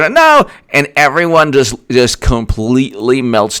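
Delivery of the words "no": high mock wail; hammed-up high-pitched protest; mock newspaper meltdown. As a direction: cry it out high in mock despair. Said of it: "And then New York".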